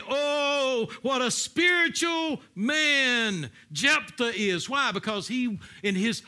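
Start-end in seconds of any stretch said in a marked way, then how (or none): 0.0-4.7 loud, high, drawn-out gushing; mock admiration. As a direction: exaggerate the crowd's awe, slowly and loudly. Imagined admirers gushing over Jephthah.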